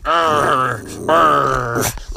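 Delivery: mock growl to rile the dog; playful growl. Growling with his dog at Josh, mock growl.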